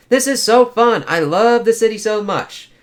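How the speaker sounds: high, loud bubbly gush; gushing date. Acted. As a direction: gush over the city like a giddy date. Double date, gushing friend.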